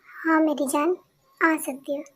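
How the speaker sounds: normal talking voice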